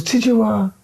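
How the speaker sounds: a high, odd caricature of a hard man asking a question; Charlie Bronson impression. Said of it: "He goes".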